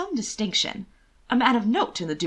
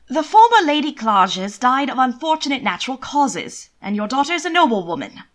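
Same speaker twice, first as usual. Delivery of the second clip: louder, flustered blurt; shocked and embarrassed; 4 semitones higher, 7 dB louder than usual. A narrator's reading. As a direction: caught out by his own indiscretion. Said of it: "sounding shocked and embarrassed".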